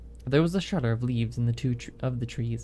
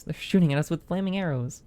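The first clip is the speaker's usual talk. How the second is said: raised, urgent warning; alarmed shout; 5 semitones higher than usual. Acted. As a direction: call out in alarm as arrows fly. Alarmed sailor under fire.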